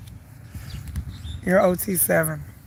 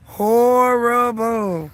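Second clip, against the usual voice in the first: "Horrible" stretched very slow and higher; emphatic drawn-out word; 4 semitones higher, much slower than usual. Real, not acted. Vlogger relaying tour reviews to viewers, "Horrible" hugely drawn out. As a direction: draw out both halves of "Horrible" for emphasis. Repeating online verdict for emphasis.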